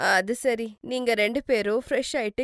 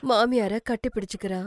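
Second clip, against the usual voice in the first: ordinary delivery, nothing marked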